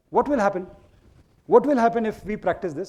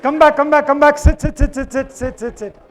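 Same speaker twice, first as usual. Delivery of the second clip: loud, high call to the room; calling everyone back; 9 semitones higher, 12 dB louder than usual. Real, not acted. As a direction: summon the group back. Calling group back to seats.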